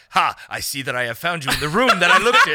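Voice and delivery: hammed-up, wordy anime proclamation; stilted anime dialogue. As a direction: declaim it like an overblown anime hero. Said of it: "Yours is like".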